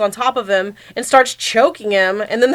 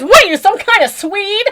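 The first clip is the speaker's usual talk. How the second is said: mock Swede question; 9 semitones higher, 5 dB louder than usual. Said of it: "this one guy who has an accent".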